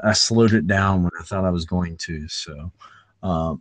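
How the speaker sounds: normal talking voice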